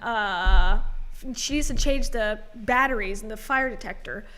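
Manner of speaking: normal talking voice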